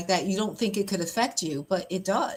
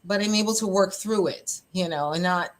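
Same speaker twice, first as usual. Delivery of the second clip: ordinary delivery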